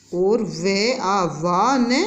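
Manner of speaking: drawn-out sound blending; slow spelling out. Shown as a demonstration. Teacher blending sounds for young pupils, very slow. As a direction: slowly piece the word together. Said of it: "दो हिस्सों में करके पढ़ते हैं".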